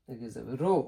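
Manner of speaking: ordinary talk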